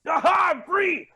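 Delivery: high triumphant cry of freedom; excited shout. Acted. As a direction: shout it out, finally free.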